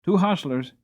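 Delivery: usual speaking voice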